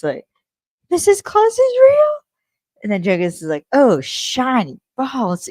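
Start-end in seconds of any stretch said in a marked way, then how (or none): 0.9-2.1 high, loud astonished squeal; amazed disbelief. Retold or imitated. Acted quote of amazed Cassie. 3.7-5.5 mock exasperation. Acted quote of exasperated Jenkins.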